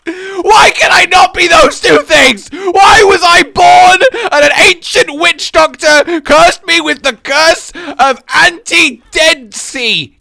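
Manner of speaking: high, loud, wailing tantrum; mock anguished rant. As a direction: yell a hammed-up lament at fate. Mock tantrum over failed creepy voice.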